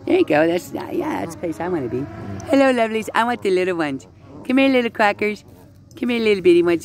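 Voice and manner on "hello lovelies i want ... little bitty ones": coaxing little ducks. Real, not acted. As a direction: coax the little ones in a high voice.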